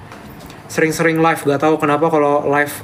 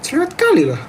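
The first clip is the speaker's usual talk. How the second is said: put-on grumble; 11 semitones higher, faster than usual. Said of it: "ngomel pakai bahasa Medan".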